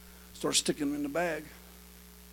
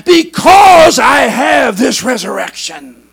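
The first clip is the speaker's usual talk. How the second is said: loud, emphatic preacher; full-force preaching; 8 semitones higher, 20 dB louder than usual. Real, not acted. Preacher to congregation on rising up by resurrection, full-force preaching.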